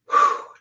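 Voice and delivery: loud blown-out calming breath; long whooshed sigh, acted out; deep breath out. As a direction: exhale audibly to ground yourself. Acts out deep grounding breath.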